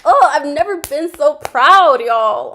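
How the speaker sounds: loud, slow, overjoyed exclamation; proud gushing. Real, not acted. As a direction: burst out proudly. Fan gushing over comeback.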